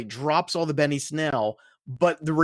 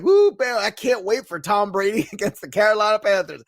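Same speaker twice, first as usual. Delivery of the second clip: louder and much higher, hyped up; retold Monday hype; excited whoop; 5 semitones higher, 5 dB louder than usual. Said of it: "I was like".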